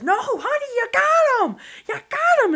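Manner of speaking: very high, loud yell; elated calling out; excited shout. Retold or imitated. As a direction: shout the good news across the field. Husband yelling confirmation.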